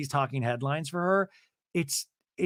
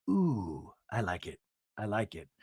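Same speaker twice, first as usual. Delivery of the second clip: low, soft, hushed "Ooh, I like it"; breathy Mary impression; 6 semitones lower, 7 dB quieter than usual. Mary tasting beer with Heather, low hushed approval. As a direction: purr the approval, much lower and softer. Mary repeats her verdict.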